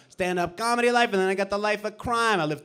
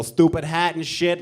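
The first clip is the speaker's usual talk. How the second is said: usual speaking voice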